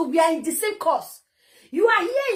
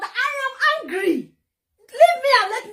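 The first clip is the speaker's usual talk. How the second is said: angry; 6 semitones higher than usual. Real, not acted. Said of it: "I said I'm angry".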